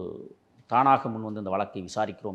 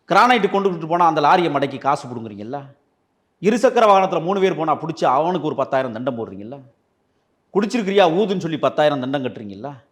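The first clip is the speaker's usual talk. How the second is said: louder and higher, building rant; angry tirade; 4 semitones higher, 6 dB louder than usual. Real, not acted. Politician attacking police corruption in a rising angry tirade. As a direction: hammer each accusation harder.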